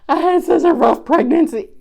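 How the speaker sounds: high, loud mock-sob story; exaggerated high, loud complainer voice; mock whining. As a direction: wail it like a hammed-up oversharer. Mocks oversharers on Instagram.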